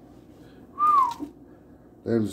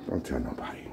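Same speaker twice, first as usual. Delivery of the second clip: breathy secret whisper; 8 semitones lower, 12 dB quieter, faster than usual. Real, not acted. Cook confiding to viewers in a whisper. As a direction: whisper it like a confession.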